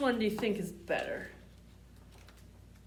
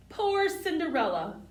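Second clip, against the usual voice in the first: mock pity; 7 semitones higher, 4 dB louder than usual. Reading story to child.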